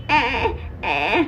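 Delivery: mock whiny toddler wail, drawn out; imitated fake crying. Mother mimics her son's fake crying wail. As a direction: ape a child's put-on crying, slow. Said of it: "false কান্না কেঁদেই যাচ্ছে".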